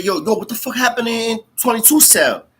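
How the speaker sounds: high-pitched, incredulous retelling; alarmed questioning. Retold or imitated. Retelling officers' talk about twenty-two cell, alarmed. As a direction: act out a stunned, urgent question.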